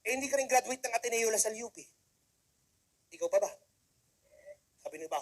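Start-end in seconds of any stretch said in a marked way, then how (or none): none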